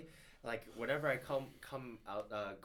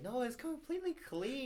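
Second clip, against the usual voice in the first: imitating visitor; 13 semitones higher than usual. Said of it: "and they're like".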